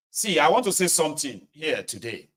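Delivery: usual speaking voice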